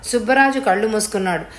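ordinary delivery

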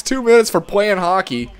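ordinary talk, nothing marked

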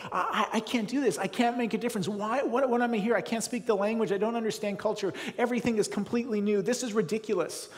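spiral into panic; agitated despair. Retold or imitated. Overwhelmed in Thailand, voicing his frantic inner doubts. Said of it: "I began to think in this moment".

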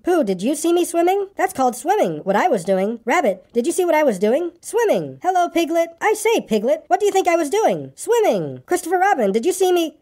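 high, eager little voice; high squeaky boasting, dropping at phrase ends; excited squeak. A narrator's reading. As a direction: squeak high to each friend, showing off. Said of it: "squeaked Roo excitedly".

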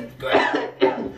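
dry rasping coughs; coughing fit. Real, not acted. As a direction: cough through a dry throat. Cinnamon challenge, coughing continues.

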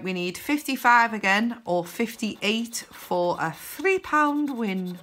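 ordinary talk

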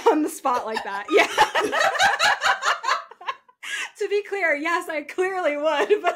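laughing through the words. Real, not acted. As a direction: giggle while answering. Laughing at teasing question.

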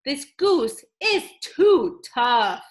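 plain reading voice

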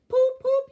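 high, loud poop poop; Gordon's loud whistle blast; engine whistle. A narrator's reading. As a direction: honk it like a big engine. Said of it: "he whistled".

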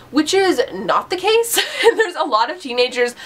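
amused, chuckling through it; breaking into a laugh. Real, not acted. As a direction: laugh as you make the point. Laughing at teen stereotype.

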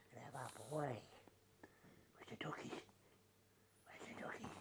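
unvoiced soft whisper. Real, not acted. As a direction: whisper it to the pup. Whispering to the dog.

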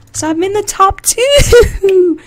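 high, loud and slow with a laugh; gleeful squeal. Real, not acted. Gamer squealing to viewers about reaching top two, laughing.

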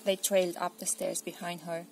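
usual speaking voice

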